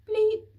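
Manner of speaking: loud high single bleep; a sharp keypad beep; loud high mock keypad beep; beep noise. Keypad beep, wrong code.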